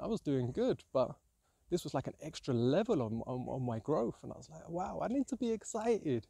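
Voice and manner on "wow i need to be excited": high, bright-eyed gasp of discovery; mock-wondrous high exclaim; excited realization. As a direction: say it like a sudden revelation. Recalling her meditation realization.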